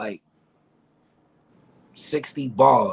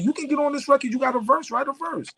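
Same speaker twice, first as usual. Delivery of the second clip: higher, eager friend voice; imitating Bryce; 13 semitones higher than usual. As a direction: push him to get on the record. Bryce urging him on.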